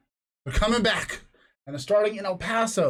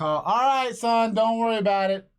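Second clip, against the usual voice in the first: dad calling across the house, higher; raised voice; 6 semitones higher than usual. Retold or imitated. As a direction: holler lightly to your son in another room. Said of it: "that's why I raised the voice".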